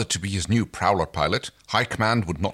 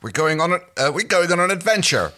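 forced cheerful reassurance; 11 semitones higher, 5 dB louder than usual. Acted. Big brother reassures little brother.

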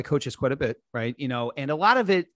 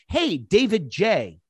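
slow, overly formal greeting, a bit higher; stiff formal greeting; 5 semitones higher, 3 dB louder, much slower than usual. Retold or imitated. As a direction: greet him stiffly by full name like a cold pitch. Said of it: "the worst thing that they do is say".

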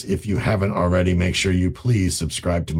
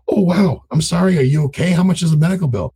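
much higher, louder, fast sympathetic concern; concerned surprise; 10 semitones higher, 4 dB louder than usual. Retold or imitated. Buyer reacts with concern to the seller's medical bill. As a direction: sound sorry and curious at once.

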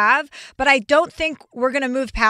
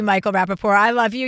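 ordinary delivery

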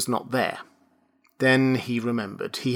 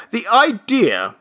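higher, louder, slow outraged shout; 10 semitones higher, 5 dB louder, slower than usual. A narrator's reading. Registrar shouts at Benjamin, outraged. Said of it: "he shouted".

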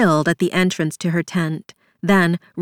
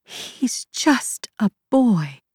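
broken repeat; 5 semitones higher, much slower than usual. A narrator's reading. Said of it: "she closed her eyes and repeated".

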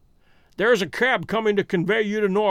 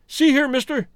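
higher, sudden challenge; suspicious cry; 9 semitones higher, 4 dB louder than usual. A narrator's reading. American challenging Holmes, crying out suspiciously. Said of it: "he cried, with a gleam of suspicion".

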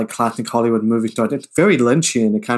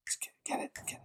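whispering; 23 dB quieter, much faster than usual. Real, not acted. Hushed reply to aside.

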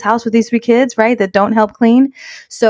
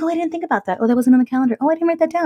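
clueless husband; 8 semitones higher, 5 dB quieter, faster than usual. Retold or imitated. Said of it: "He's like".

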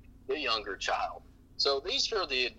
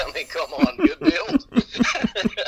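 high laughing delight; 7 dB louder, much slower than usual. Real, not acted. Laughing to the host about his son.

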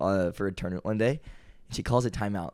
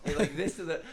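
normal talking voice

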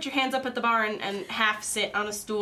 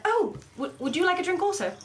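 higher, relieved bartender voice lifting on the offer; relieved barmaid; 9 semitones higher, faster than usual. Acted. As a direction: sound glad to change the subject. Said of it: "sighs in relief".

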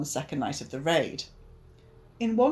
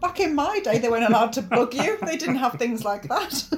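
put-on baffled old crook, higher and louder; put-on old-timer; 6 semitones higher, 5 dB louder than usual. Host mocking the analog criminals caught by bugging, put-on old-timer. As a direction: protest like an indignant old-timer. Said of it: "So they go".

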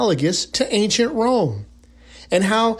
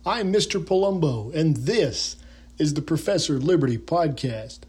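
normal talking voice

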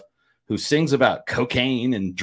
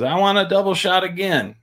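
higher-pitched mock bar order; imitated drunk demand; 7 semitones higher than usual. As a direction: demand a drink like a wasted regular. Drunk singer demands another drink.

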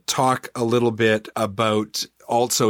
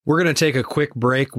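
ordinary talk

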